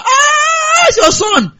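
high, delighted cry of a mother; excited mother. Pretend. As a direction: greet the news with joy. Mother calls about the son.

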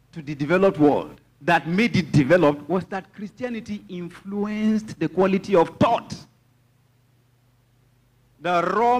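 high, emphatic preacher's delivery; impassioned preaching. Real, not acted. Preacher teaching congregation that wealth is thought, impassioned preaching. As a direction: drive the point home from the pulpit, pitched high.

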